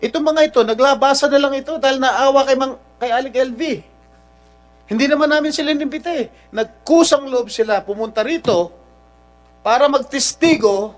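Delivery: real angry tirade, high and loud. Real, not acted. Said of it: "raising my voice". (galit na galit, pasigaw na panunumbat)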